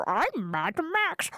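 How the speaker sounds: slow, drawn-out silly growl; hammed-up action hero, then gibberish; Tom Hardy as Mad Max. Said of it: "turned up and was like".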